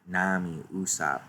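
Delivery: ordinary talk, nothing marked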